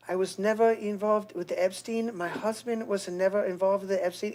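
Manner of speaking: put-on Melania accent. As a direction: do a soft, careful Eastern European accent. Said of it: "I watched her when she did the".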